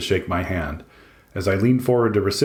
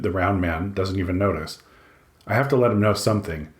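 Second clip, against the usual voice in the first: usual speaking voice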